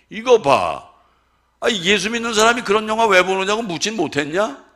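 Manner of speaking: loud, high scolding of the wife; retold scolding. Husband scolding his wife, loud retold anger. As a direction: berate her loudly as in the moment.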